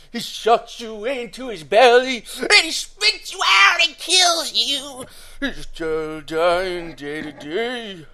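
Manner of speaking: slow, loud, overwrought wail; dramatic mock horror. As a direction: ham it up like a horror tale. Dramatic joke about the character.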